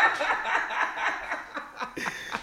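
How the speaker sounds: high wheezing laugh with no voice; breathless silent laugh. Real, not acted. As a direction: laugh so hard no sound comes out.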